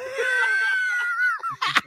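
a high shriek of laughter bursting out. Real, not acted. Remembering how worried he was, shrieking laugh. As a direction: explode into a squealing laugh.

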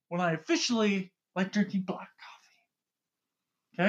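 a hushed, breathy reveal; dramatic whisper. Acted. Host whispering his big coffee confession to cohost.

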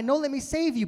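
high, loud rescuer's cry; urgent pleading. Acted. Rescuer pleading with a random guy, urgent pleading. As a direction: call out desperately to save him.

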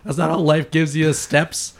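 high, loud cry of Cheater! breaking into laughter; squealing with laughter at a cheating mom; laughing accusation. Retold or imitated. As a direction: tease Mom, cracking up as you call her out. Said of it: "And I, I would be like".

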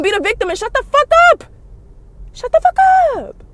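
high, shouted shut the fuck up; exasperated shout. Real, not acted. Ranting at a singer online, high exasperated shout. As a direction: snap at her to shut up, voice shooting up.

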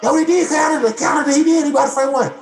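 high, shouted hammed-up cheering; mock media shout. Parodying the media celebrating Toronto, hammed-up shout. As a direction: yell it like a gushing commentator. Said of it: "The media, everybody".

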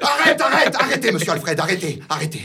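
high laughing stop stop; laughing plea. Acted. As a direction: beg him to stop, laughing. Begging the author to stop.

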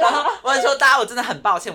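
loud, high apology through laughter; loud laughing sorry to the viewers. Real, not acted. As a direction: apologize while cracking up.

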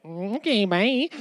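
lightly mocked awkward friend; awkward exit. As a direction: back out of the room, weirded out. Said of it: "They're like".

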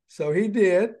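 ordinary talk, nothing marked

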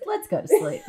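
high, giggly delivery; laughing quote. Retold or imitated. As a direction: crack up while acting out the punchline. Laughs while quoting the punchline.

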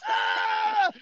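very high, startled cry imitating dad; panicked yelp. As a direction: squeal it like you're swerving at geese. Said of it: "He goes".

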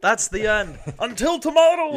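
high shouted sign-off; rousing mock pub shout, building; Irish cheer. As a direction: holler it out as a toast's last line. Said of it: "That was like".

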